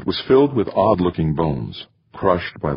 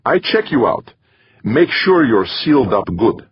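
put-on higher-pitched Middle European accent; 7 semitones higher, 4 dB louder than usual. A narrator's reading. Safety officer announcing herself to a colleague, Middle European accent. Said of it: "in her Middle European accent".

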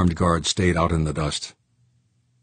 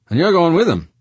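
higher, louder rough miner voice; gruff George voice; 12 semitones higher, 7 dB louder than usual. Acted.

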